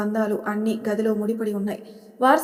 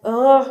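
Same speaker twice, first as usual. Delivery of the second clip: a loud, straining yell, louder than his talk; furious yell; 3 semitones higher, 8 dB louder than usual. A narrator's reading. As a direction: let out a loud angry "ఆ". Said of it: "అని గట్టిగా అరుస్తాడు, నరాలన్నీ తేలే విధంగా".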